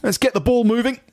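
high, put-on colleague voice; blustering office chap. Reading a list of office malapropisms, high character voice. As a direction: deliver each mangled saying as the chap. Acts out office chap's phrases.

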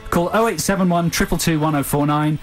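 usual speaking voice

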